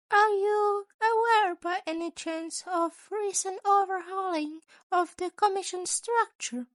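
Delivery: a sly, chipper school principal asking; Nezu's voice. A narrator's reading.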